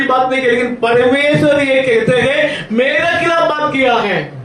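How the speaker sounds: high, emphatic sermon delivery; full-force preaching. Acted. Preacher warns against speaking against Moses, forceful preaching. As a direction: press the warning on the congregation.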